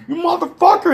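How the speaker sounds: high-pitched mock rage; imitating an enraged man lunging; furious curse. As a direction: spit it out high and angry. Insulted man swings at teller.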